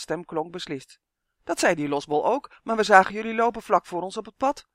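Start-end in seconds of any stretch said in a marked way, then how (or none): 1.4-4.7 higher, louder protest; calling out. A narrator's reading. Justin insisting to Tonia they saw them, calling out. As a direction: call it out insistently. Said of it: "riep Justin".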